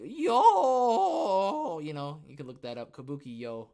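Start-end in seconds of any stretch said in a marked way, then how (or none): 0.0-1.8 high, loud stretched yo; hammed-up yo. Mimicking the kabuki meme with a stretched yo. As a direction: belt it like a kabuki cry.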